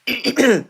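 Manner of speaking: a short cough after signing off; one quick cough; a brief chesty cough; real cough. Real, not acted. Sick host coughing on live.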